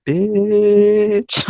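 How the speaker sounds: long, high drawn-out exclamation; hammed-up indignant wail; mock outrage. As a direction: stretch the one word out in disbelief. Acted retort to the cashier.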